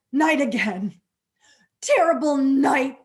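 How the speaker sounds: loud, wailing cry at the night; yelled. A narrator's reading. Paul yelling at the night in fear. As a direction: cry out to the dark sky in dread. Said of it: "And he yelled so loud".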